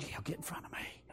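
barely audible, urgent-free hushed whisper. Retold or imitated. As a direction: whisper it under your breath to her. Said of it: "I whispered to her".

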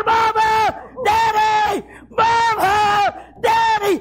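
put-on high, desperate child's calls; hollering for parents. Children searching upstairs for parents, desperate hollering. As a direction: shout out high, drawing out each name. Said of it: "and they holler".

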